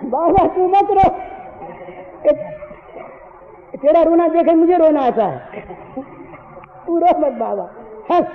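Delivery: put-on a small child begging his father to stop crying; child comforting father. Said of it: "ठोडी पकड़ कर बोले".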